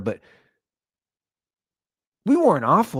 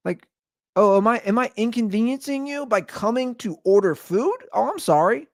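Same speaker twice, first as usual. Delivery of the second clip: mock-apologetic, pitched higher; sarcastic; 5 semitones higher than usual. Customer to sullen Chipotle worker, sarcastic apology. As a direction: lay on fake politeness, voice lifted. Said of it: "I just get very sarcastic".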